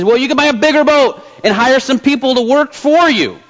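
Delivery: irritated businessman, pitched well up; a touch of exasperation, high and insistent; mildly irritated. A narrator's reading. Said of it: "was beginning to a little bit irritated".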